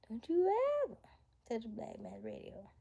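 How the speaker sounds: movie quote starting very high-pitched; Chris Tucker. Acted. Streamer teasing a viewer with a movie line, high-voiced impersonation. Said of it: "as a Chris Tucker in Rush Hour when he said".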